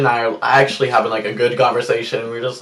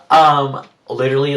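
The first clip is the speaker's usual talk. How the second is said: ordinary talk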